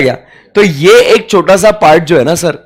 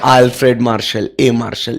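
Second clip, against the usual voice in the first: affectionately; 6 semitones lower, 5 dB quieter than usual. Shown as a demonstration. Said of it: "अपना प्यारा बेटा कौन".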